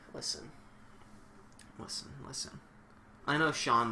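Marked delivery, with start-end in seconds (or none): 0.1-2.6 whispered, much softer urging; hushed listen. Acted. Streamer hushing the commentator in a mock argument, whispered. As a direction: lean in and whisper to calm him.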